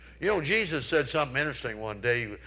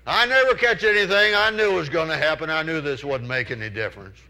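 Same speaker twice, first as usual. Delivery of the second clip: high, louder wail of frustration, picking up speed; loud whining complaint; 3 semitones higher, 7 dB louder than usual. Retold or imitated. Friend complains loudly to Charles about catching nothing. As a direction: howl the complaint that nothing changes.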